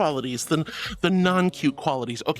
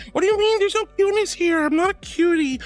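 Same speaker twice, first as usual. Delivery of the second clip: high-pitched, louder mock outrage; playful, pitched-up disbelief; flustered denial; 11 semitones higher, 4 dB louder than usual. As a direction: squeak an indignant protest at a compliment. Flustered denial of being cute.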